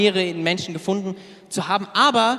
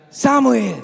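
God calling out; 7 semitones higher, 5 dB louder than usual. Retold or imitated. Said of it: "hört er wieder eine Stimme".

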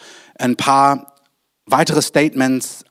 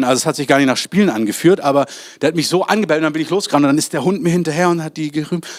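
ordinary talk

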